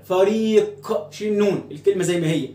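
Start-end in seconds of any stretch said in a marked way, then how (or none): 0.1-1.1 "فريق" drawn out very slowly, ending on q; nun removed. Shown as a demonstration. Teacher removes the final nun to test, ending dropped. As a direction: stretch "fariiq" with no -an after it. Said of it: "بنشيل النون في الآخر".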